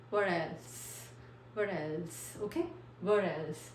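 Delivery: "What else" with a soft flapped D for the T, stress on "else"; pron demo. Shown as a demonstration. Teacher to students: "What else" with a soft D sound. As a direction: glide the t of "What else" into a light d. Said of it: "कैसे pronounce करना है".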